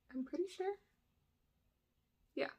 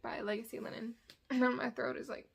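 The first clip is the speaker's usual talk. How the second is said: normal talking voice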